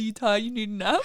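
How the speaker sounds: put-on talking to a kid. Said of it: "when you ask a kid".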